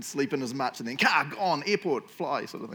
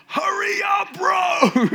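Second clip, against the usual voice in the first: much higher, louder mock yell; impatient shout; 9 dB louder, much slower than usual. Urging the slow cop on, with a loud mock shout. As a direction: yell it out to hurry him. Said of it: "shake him and be like".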